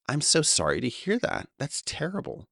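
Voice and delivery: high, sing-song politeness; polite sympathy. Retold or imitated. Consoling a friend over his stolen car, mock polite sympathy. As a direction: lay on the niceties. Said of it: "my propensity for social niceties instantly kicked in".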